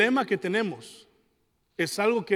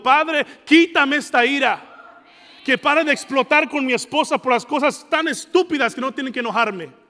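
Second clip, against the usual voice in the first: shouted prayer; 4 semitones higher, 6 dB louder than usual. Retold or imitated. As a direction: belt it out like a desperate plea. Pastor voices a desperate prayer.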